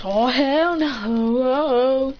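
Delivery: very slow, stretched-out protest; indignant refusal. Acted. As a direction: drag it out in mock outrage.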